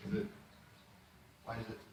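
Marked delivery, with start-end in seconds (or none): none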